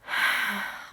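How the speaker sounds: long breathy giving-in sigh; resigned child sigh. Retold or imitated. Son giving in before the calm down place, a long sigh. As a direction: let the air out like a kid giving up. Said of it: "and then he would go".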